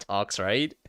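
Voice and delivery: ordinary delivery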